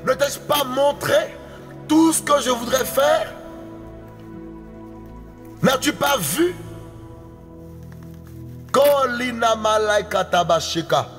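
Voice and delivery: usual speaking voice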